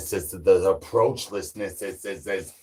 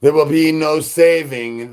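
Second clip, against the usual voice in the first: loud proclamation; 5 semitones higher, 6 dB louder than usual. Acted. Announcer proclamation over music.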